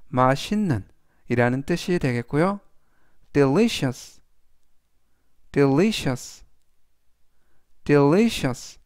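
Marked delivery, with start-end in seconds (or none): 3.3-8.8 "delicious" stressed on the second syllable, de-LI-cious; teacher models "delicious" for learners; model pronunciation. Shown as a demonstration. Teacher reads "delicious" aloud for students, clear and steady. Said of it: "라고 발음하시면 되겠습니다".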